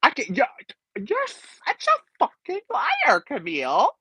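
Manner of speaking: very high, slow, gagged squeal; stunned, shrill reality TV accusation; imitating a stunned Housewife. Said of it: "And she's like".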